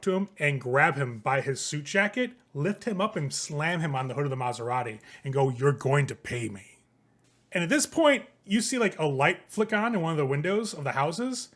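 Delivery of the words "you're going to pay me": slow, low-voiced menace; menacing Jason. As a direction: lean in and threaten slowly.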